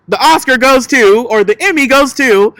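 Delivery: high, loud mock award announcement; sarcastic awards host voice; mock announcer.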